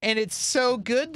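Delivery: ordinary talk, nothing marked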